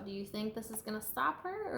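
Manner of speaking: usual speaking voice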